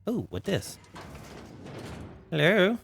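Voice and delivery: normal talking voice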